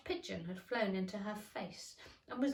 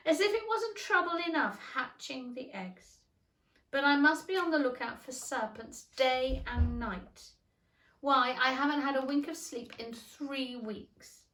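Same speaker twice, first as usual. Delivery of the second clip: weary pigeon; 4 semitones higher, 6 dB louder than usual. A narrator's reading. Pigeon's lament continues.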